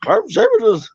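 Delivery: high, R-mangled talking dog; cartoon dog. Comedian playing the dog replying to Shaggy, high mangled voice. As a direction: howl the words like a cartoon hound. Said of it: "do you wanna hear some impressions".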